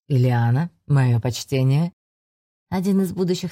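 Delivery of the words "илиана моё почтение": put-on low, amused old mentor; a lower, wry older mage; older man voice. A narrator's reading. Reinard greets old friend.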